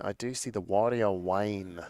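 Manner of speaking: a slight put-on regional accent on Warrior Wayne; half-intended accent, slowed a little; odd accent. Real, not acted. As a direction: slip into a mild accent for the card name. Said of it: "Don't know why I put it in that accent".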